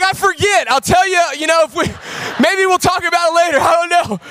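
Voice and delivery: high, fast, nervous evasion; nervous dodging. Retold or imitated. Dad dodging his wife's question on the phone, high nervous babble. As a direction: squirm out of answering. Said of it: "I'm like".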